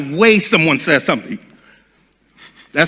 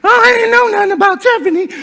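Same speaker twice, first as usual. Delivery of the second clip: much higher, quick indignant squeal; high mock Cheryl voice; 4 dB louder, faster than usual. Cheryl hearing about Tiffany, in a high mock squeal. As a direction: rattle it off high and hurt.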